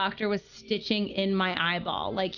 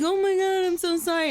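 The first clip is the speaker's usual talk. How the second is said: mild mock distress, pitched up; caretaker apologizing; 7 semitones higher than usual. Foster mother rushing in to the child, higher mock apology. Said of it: "she's like".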